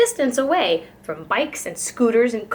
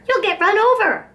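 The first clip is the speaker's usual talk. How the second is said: much higher, louder panicked squawk; alarmed squawk; 10 semitones higher, 4 dB louder than usual. A narrator's reading. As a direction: shriek a warning in a flustered dither. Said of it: "all of a dither".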